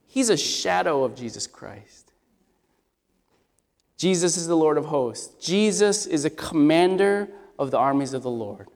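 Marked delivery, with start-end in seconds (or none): none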